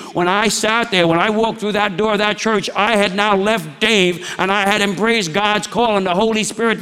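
fervent preaching. Acted. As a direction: preach it out, pressing the congregation. Preaches at full force again.

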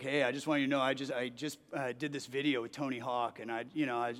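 put-on young pro skater telling his pastor news, low and relaxed; deep laid-back voice. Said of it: "He's like".

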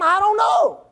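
exasperated preacher's cry; exasperated shout. Real, not acted. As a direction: throw up your hands and shout it. Exasperated at Adam's silence.

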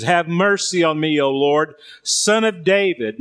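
ordinary delivery

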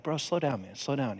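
very soft, low aside; hushed calming. Retold or imitated. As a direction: quietly settle down a sobbing stranger. Hushing a blubbering stranger.

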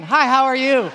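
loud, high, overeager hi, how are you; eager greeting. Retold or imitated. Escaped man greeting cafe strangers, loud and overeager. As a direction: burst out with the greeting, hungry for company. Starved for contact, greeting people.

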